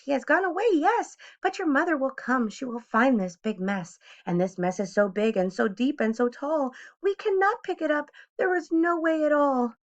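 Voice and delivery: high, fretful fish voice; worried fish. A narrator's reading. Fish frets to the children, high pitched. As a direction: fret about the huge mess.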